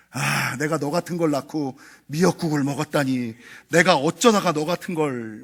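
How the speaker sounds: sighing, contemptuous mother's voice, low; scornful mother. Retold or imitated. Mother scorning her child, in a muted contemptuous voice. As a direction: say it with disdain, as if the child is a burden. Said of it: "뭐, 이런 말 있잖아요".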